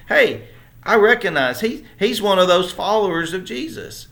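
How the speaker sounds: high, loud pointed accusation; a bystander girl calling out Peter, high and loud; accusing servant girl. Retold or imitated. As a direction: call him out in front of the crowd.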